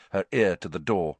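usual speaking voice

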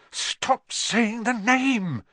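high, drawn-out frightened plea; terrified whisper. A narrator's reading. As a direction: beg them in a scared, strained stage whisper. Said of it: "in a terrified whisper".

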